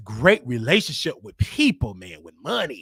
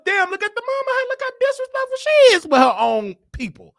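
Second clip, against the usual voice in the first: much higher, incredulous exclamation; can you believe her, pitched way up; exasperated; 5 dB louder, faster than usual. Real, not acted. Complaining about the mama's disrespect to her own people, high and incredulous.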